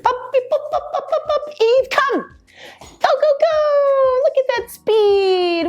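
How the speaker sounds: very high, excited puppy praise; squeaky praising a dog. Real, not acted. Calling puppy, cheering.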